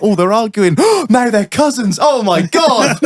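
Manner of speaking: high, gasping mock shock; mock soap-viewer excitement. Viewer reacting to workmates' live soap, mock shock. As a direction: ham up the scandalised reactions, stretching the last.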